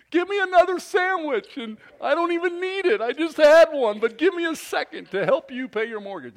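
high, loud hammed-up eagerness; mock eager customer. Preacher playing a hungry customer, high hammed-up begging. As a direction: beg for a sandwich in a put-on high voice. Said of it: "I'm like".

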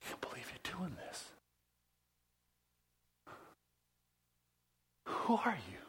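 stunned, half-whispered words; hushed disbelief. Acted. Humbled Peter questioning Jesus at the foot washing, hushed disbelief. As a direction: barely voice it, as if dumbfounded.

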